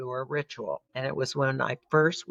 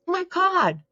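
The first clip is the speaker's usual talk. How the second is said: much higher, a shocked exclamation; shocked gasp. Retold or imitated. Sister sees welts in the mirror, shocked. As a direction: let it jump up in disbelief.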